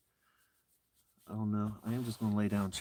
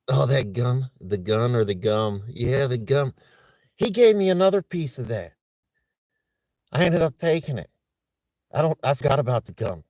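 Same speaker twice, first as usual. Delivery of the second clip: much louder and higher, buzzing; amped up; 4 semitones higher, 13 dB louder than usual. Real, not acted. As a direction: rattle it off all wired. Realizing gum got her amped.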